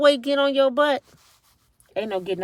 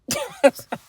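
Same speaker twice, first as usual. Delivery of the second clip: a high burst of laughter; 13 semitones higher than usual. Real, not acted. Tired vlogger laughing at herself, high-pitched laugh. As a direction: laugh at how broken down you are.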